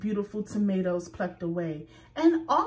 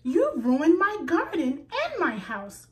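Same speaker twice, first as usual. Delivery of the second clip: raised, upset cry, pitched up; cried out; 7 semitones higher, 4 dB louder than usual. A narrator's reading. Rabbit crying out at Squirrel over her ruined garden, upset. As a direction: let it burst out in hurt anger. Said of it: "cried Rabbit".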